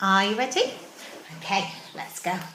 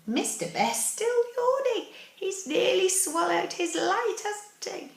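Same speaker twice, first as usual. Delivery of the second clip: high amused aside; playful; 13 semitones higher than usual. Real, not acted. Playful aside about yawning.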